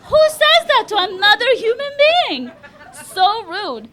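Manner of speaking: high, loud comic outrage; outraged shout. Acted. Comic appalled at a date's crude remark, outraged shout. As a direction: yell it indignantly, pitch way up.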